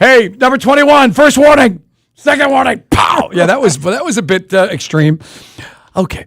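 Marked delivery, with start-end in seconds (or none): 0.0-3.3 loud, slow drill-sergeant call; mock coach warning. Cohost playing a camp leader warning a straggler. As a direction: bark it like a referee.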